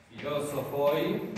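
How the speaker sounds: Filosofoi spoken slowly with a rising end; slow demo pronunciation. Shown as a demonstration. Teacher models the plural form for students. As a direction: sound out Filosofoi carefully. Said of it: "el vocativo es igual al nominativo".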